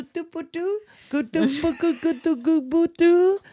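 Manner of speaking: high mock French gibberish; fake French. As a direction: babble nonsense as mock French. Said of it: "that's not even French".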